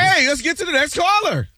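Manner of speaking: high-pitched cut-in over an argument; urgent interruption. Real, not acted. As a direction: pipe up high to move things along. Breaking up the hosts' argument.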